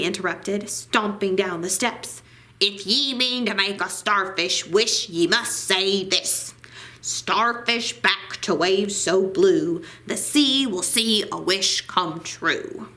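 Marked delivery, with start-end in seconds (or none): 2.6-12.9 grumbling sea captain laying down the rule; gruff old sailor. Acted. Narrator voices grumpy old sailor.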